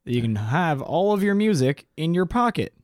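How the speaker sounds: mock the boss who dreams big and dumps the work; Steve Jobs. Imitating Steve Jobs ordering his engineer, in a high hammed-up voice. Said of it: "Steve Jobs is like".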